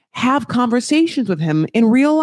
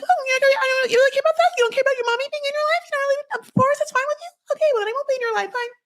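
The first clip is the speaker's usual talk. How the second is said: very high, squeaky rapid-fire mimicry; mocking Molly; much faster than usual. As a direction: parody an offended mother in a racing high voice. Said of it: "And then Molly's like".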